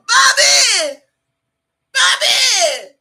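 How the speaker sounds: high, drawn-out full-voiced yell; hollering for someone. Woman calling for Bobby who never came, loud holler. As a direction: holler the name down the street.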